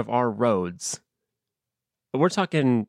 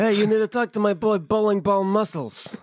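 higher tough-guy mobster voice; mob guy voice; 9 semitones higher than usual. Pretend. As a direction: talk like a street hustler vouching for a friend. Mobster introducing his boy.

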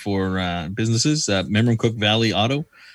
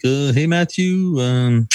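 hammed-up French mechanic, higher and slow; French accent; 4 semitones higher, much slower than usual. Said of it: "Are we doing accents?".

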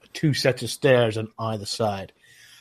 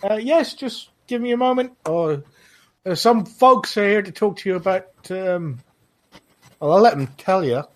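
lightly voiced high elf, a bit higher; Nenya's voice; 9 semitones higher, 4 dB louder than usual. GM as Nenya answering the guard, lightly pitched up. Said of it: "she goes".

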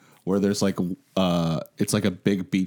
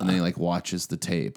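usual speaking voice

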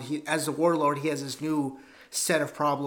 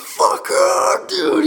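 loud, higher shout of disbelief; excited shout; 12 semitones higher, 10 dB louder, slower than usual. Retold or imitated. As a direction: yell it like a thrilled fan. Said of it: "And I was like".

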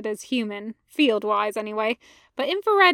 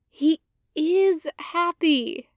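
reassuring; 5 semitones higher, much slower than usual. Acted. Ahsoka reassures Anakin.

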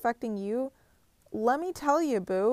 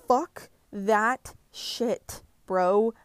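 defiant outburst; 5 dB louder, much slower than usual. Retold or imitated. Angry self-talk, inner outburst.

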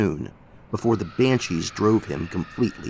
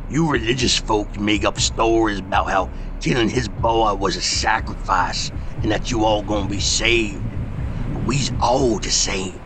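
grim rustic preacher of doom. Acted. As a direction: hammer each line down without pity. Josiah's character voice.

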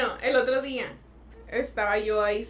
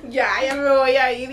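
loud laughing self quote; 10 dB louder than usual. Retold or imitated. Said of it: "le dije".

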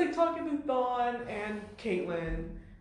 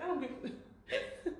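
laughing; 6 semitones higher, 8 dB quieter, slower than usual. Retold or imitated. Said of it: "Barry's like".

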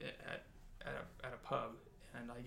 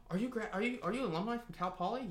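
higher-pitched, eager imitation of strangers; excited strangers; 9 semitones higher, 4 dB louder than usual. Strangers spotting mom's Cal Poly sweater, excited. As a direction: ask it high, stumbling over the first words.

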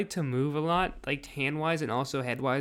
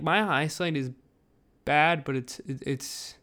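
ordinary delivery

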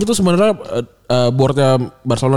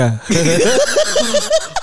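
high laughing burst; 10 semitones higher than usual. Real, not acted. Host cracks up at own joke. (tawa lepas melengking)

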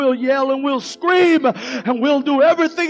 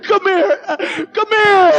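joyful tearful call; 5 semitones higher than usual. Retold or imitated. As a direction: call the bird over, overjoyed. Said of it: "He said".

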